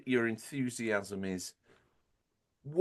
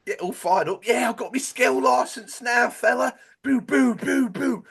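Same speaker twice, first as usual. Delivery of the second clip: hammed-up Cockney bloke, much higher and louder, shouting; Cockney impression; 13 semitones higher, 9 dB louder than usual. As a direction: play a pumped-up Cockney lad yelling at his mate. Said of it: "you make me Cockney every time you do this".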